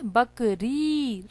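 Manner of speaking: बकरी with the final री drawn out; long ई sound. Shown as a demonstration. Teacher showing children the vowel sign, बकरी with a long final री. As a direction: stretch the "ee" ending of बकरी. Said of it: "रा के साथ ई का sound".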